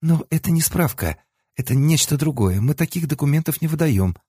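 high, prim officious clerk; bureaucrat voice. A narrator's reading. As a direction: recite the rules like a petty clerk. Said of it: "включила бюрократа".

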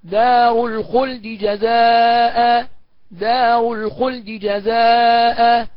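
very slow chanted دار الخلد جزاء with a fleeting vowel on the د; vowel snatching. Shown as a demonstration. Teacher demonstrating the snatched-vowel reading. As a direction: let the dal's vowel flicker, don't merge. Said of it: "والاختلاس كده اهو".

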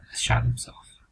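hushed whisper of "has shot himself"; grave whispered repeat. Acted. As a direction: breathe it out as a secret.

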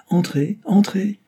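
high, rising call to come in. A narrator's reading. Mechanic calls to a visitor at the door, calling out.

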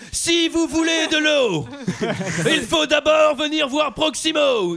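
high, loud and very slow, like a pompous showman; hammed-up announcer booming out his own name; Proximo's grand speech. Mocking Proximo's speeches, pompous high booming voice. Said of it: "ne parle qu'en speech".

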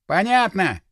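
ordinary delivery

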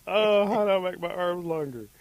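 high, loud mock lament; wailing rabbit. Rabbit despairs over his short arms, hammed-up wail.

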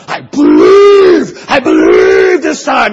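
mock-sobbing cry, loud and stretched out; a pleading child straining to truly believe; desperate tearful plea. Child desperately praying sinner's prayer.

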